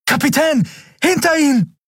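very high, urgent cry of alarm; warning shout. A narrator's reading. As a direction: yell a desperate warning across the room. Miko shouts warning to captain. (schriller, gerufener Warnschrei)

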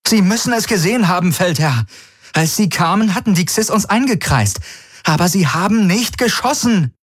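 hoch, FÜNF schrill betont; bestimmt, mit verdeckter Furcht; bestimmt, selbstsicher. A narrator's reading. Kirai argumentiert. (Kirai, hoch und bestimmt, Angst überspielend)

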